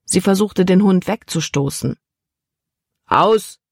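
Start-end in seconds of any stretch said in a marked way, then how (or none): 3.1-3.6 firm, raised one-word order to the dog; sharp command. A narrator's reading. Captain orders the jumping dog off Libby, sharp command. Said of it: "befahl der Kapitän".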